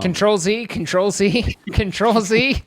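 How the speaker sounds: frantic undo, played for laughs; mock-urgent retraction. Taking back a bad joke, mock-urgent. Retracting what was just said.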